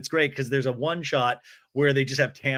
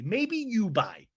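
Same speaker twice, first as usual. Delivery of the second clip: mocking imitation; 7 semitones higher, much slower than usual.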